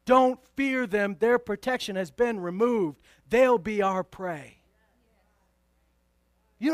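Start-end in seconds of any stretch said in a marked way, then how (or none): 0.0-4.6 slow, loud and firm, each word planted; bold declaration. Retold or imitated. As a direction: proclaim it like a victory already won. Joshua and Caleb's bold reply.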